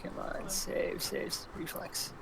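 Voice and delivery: very soft, slow, half-whispered; muttering to oneself. Real, not acted. Player hunting for the reflex save, muttering to self. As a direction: mumble it under your breath while searching.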